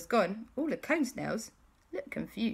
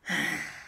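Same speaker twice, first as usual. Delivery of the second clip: long voiceless exhale; sigh of relief. Acted. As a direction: breathe out hugely, relieved. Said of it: "breathed a huge sigh of relief".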